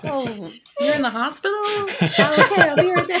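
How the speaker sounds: high, laughing mock phone answer; pretend phone call. Dodging the topic with a fake hospital call, laughing. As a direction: fake taking an urgent call to escape.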